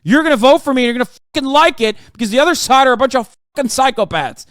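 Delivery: sneering questions that build to a loud, high, fast shouted tirade; mocking a politician who snaps from talking down to screaming; mock condescending rant. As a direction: start patronising, then explode at the voters. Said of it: "condescendingly telling their fucking voters".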